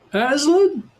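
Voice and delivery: high astonished squeak of a beaver; a beaver stunned anyone would ask; surprised beaver. A narrator's reading. Beaver amazed the children don't know Aslan, high surprised.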